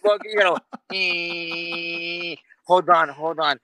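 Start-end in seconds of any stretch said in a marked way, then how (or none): 0.9-2.3 long drawn-out electric seat whirr; imitated seat motor. Slow car seat moving back, imitated motor whine. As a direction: hold a steady motor whine.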